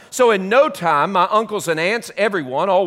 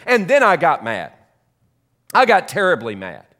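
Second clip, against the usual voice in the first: plain reading voice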